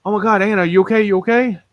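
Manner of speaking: high-pitched fretting softy voice; softy mode. Retold or imitated. Dad checking on flipped-over Anna, softy fretting. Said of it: "I went into freaking softy mode".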